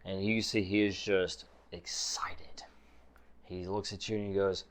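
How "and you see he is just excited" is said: usual speaking voice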